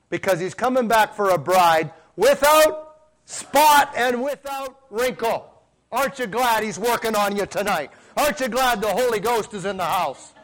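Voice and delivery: preaching at full force. Acted. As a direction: lift the house with it.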